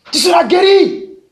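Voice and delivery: impassioned faith-healer shout. Retold or imitated. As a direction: yell the promise of healing at the crowd. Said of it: "Le gars crie".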